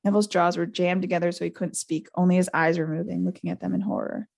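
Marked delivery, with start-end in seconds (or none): none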